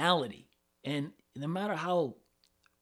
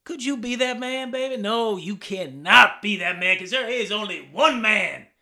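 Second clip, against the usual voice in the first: put-on loud, boastful southern accent with a swagger; a southern-accented lady's man, bragging; southern wrestler accent; 2 semitones higher, 8 dB louder than usual. Comic's wrestler bragging in a southern accent. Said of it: "Gotta have a little southern accent, baby".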